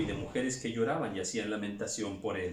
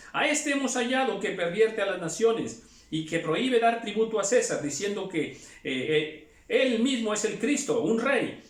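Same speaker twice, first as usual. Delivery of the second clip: loud, higher accusing cry; accusing shout; 7 semitones higher, 4 dB louder than usual. Acted. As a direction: hurl the charges at Pilate. Accusers before Pilate. (acusación a gritos)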